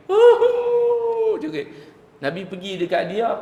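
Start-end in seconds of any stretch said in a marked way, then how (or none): 0.1-1.4 high wailing sob, way up in the voice; mock wailing sob. Retelling the weeping woman's loud wail. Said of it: "Jerit lah, nangis".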